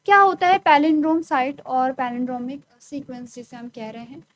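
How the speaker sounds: ordinary talk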